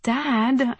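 teeth clenched, exasperated; through gritted teeth. A narrator's reading. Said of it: "I said through gritted teeth".

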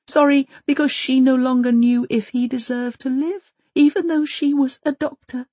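high, cracking, tearful voice. Acted. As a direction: let her voice crack and thin to whistles. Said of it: "Maud's voice cracks until her words are like little whistles".

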